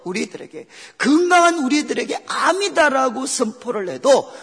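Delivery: loud, driving sermon delivery from the pulpit; forceful preaching. Acted. Pastor driving the point home to the congregation, loud and urgent.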